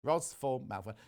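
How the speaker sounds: "form" said for "from"; misspelling read literally. Real, not acted. Presenter repeating a viewer's misspelt sign-off, literal "form". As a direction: repeat the typo "form" as written. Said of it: "they've spelt from wrong".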